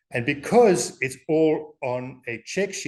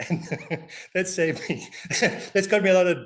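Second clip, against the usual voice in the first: ordinary delivery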